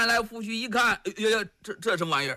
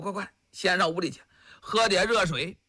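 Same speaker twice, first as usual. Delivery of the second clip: usual speaking voice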